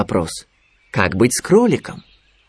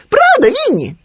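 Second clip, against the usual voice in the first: very high, loud excited piping; Khryunya catching on; 5 dB louder than usual. A narrator's reading. Khryunya working out Winnie's plan, high excited squeak. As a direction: blurt the guess in a high squeak. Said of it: "догадался Хрюня".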